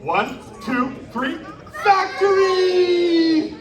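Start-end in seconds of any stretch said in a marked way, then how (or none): 1.8-3.5 loud, high, stretched-out yell; loud group shout. Real, not acted. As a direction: drag the word out in one big shout. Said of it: "We're gonna shout factory real loud".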